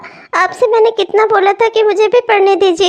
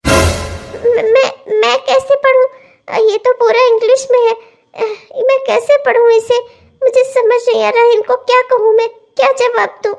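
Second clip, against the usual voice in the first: tearful panic; 2 semitones higher than usual. Acted. Wife who cannot read English answers her husband, tearful and stammering. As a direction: stammer helplessly through small sobbing pauses.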